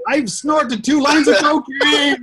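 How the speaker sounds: high, drawn-out manic shout; hammed-up frantic pitchman yell; Billy Mays parody. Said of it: "It's more like".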